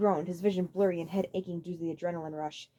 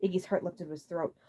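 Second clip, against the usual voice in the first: ordinary delivery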